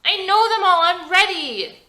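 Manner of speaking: put-on high, loud kid voice; an eager ten-year-old; young boy. A narrator's reading. Jordy asking his dad for help with the mask, high eager kid voice.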